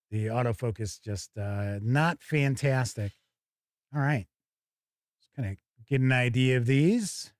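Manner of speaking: normal talking voice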